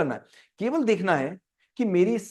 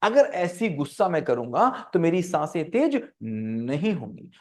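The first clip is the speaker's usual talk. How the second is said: ordinary talk, nothing marked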